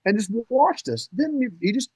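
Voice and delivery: high-pitched amazement, reliving the moment; incredulous storytelling, pitched well up; retold astonishment. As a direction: relive the shock of spotting him. Acts out astonishment at the buck.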